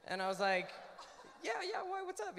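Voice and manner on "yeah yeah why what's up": high, quick, eager yeah yeah; eager reply. Retold or imitated. Answering an old friend's call, eager and high. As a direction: perk up, curious what he wants.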